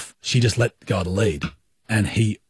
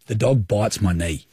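ordinary delivery

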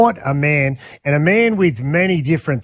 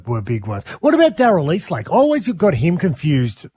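ordinary talk, nothing marked

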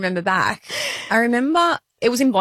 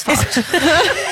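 laughing; 6 semitones higher than usual. Real, not acted. Repeating, breaking into laughter.

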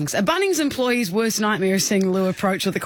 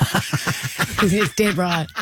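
laughing; much slower than usual. Real, not acted. Laughing at own joke.

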